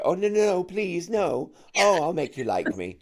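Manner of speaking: high, whimpering plea to a bully; pleading with a bully. Retold or imitated. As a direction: beg the bully to like you, pitch up. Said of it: "with the bully where you go".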